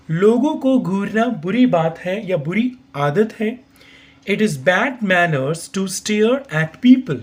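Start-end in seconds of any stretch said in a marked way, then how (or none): 4.3-7.2 slow, careful teacher's model reading; slow model sentence. Shown as a demonstration. Teacher slowly models pronunciation for learners. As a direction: say each word slowly so students can copy. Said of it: "pronunciation के according देखिए".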